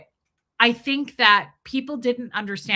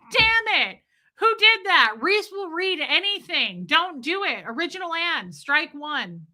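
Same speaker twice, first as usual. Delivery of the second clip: mock frantic mods; 7 semitones higher than usual. Imitating the mods scolding Original Ann. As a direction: panic over what she'll read. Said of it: "the mods are like".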